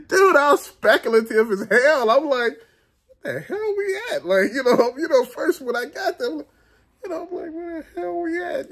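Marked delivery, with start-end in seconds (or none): 3.1-4.3 high, hushed, baffled question; bewildered aside. Retold or imitated. Arriving at a strange place, bewildered hushed question. Acts out his bewildered reaction. 7.5-8.7 high, hushed, baffled question; bewildered aside. Retold or imitated. Arriving at a strange place, bewildered hushed question. Repeats his bewildered reaction.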